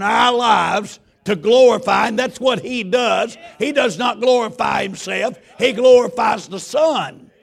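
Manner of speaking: loud, slow, rising sermon shout; full-force preaching. Acted. Pastor preaching to the congregation about the Holy Ghost, loud.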